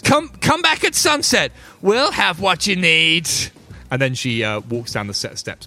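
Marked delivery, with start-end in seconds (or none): 0.0-3.3 high, slow, loud mysterious promise; NPC voice. Acted. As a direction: draw it out like a secretive shopkeeper. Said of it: "And she says".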